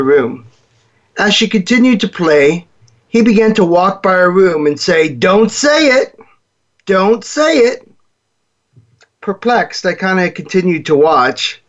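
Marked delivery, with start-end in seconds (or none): 5.1-7.9 high, slow, insistent warning; mock angry warning. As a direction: slowly warn her, pitched up and tense. Boy warning his sister.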